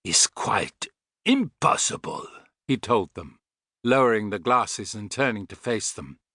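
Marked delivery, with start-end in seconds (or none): 0.0-2.3 put-on slow, harsh German-accented denial; harsh Teutonic accent. A narrator's reading. Thin man lowering the glasses, harsh Teutonic accent. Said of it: "in a harsh Teutonic accent".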